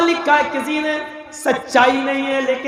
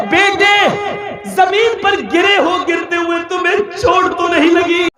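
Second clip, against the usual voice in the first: mother's heart calling out; 5 semitones higher than usual. Acted. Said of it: "کلیجے سے آواز آتی ہے".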